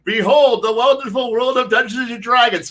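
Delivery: mock ride announcer. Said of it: "put all my players in a roller coaster and go".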